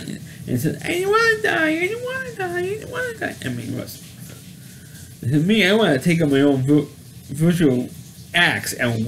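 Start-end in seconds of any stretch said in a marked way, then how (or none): 0.8-3.4 very high, weepy whine that fades softer; mock a whimpering piglet, trailing off; whining, crying pig. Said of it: "you hear Wilbur whining and complaining and crying".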